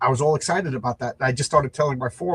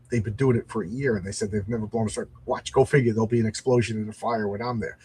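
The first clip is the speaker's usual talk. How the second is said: ordinary delivery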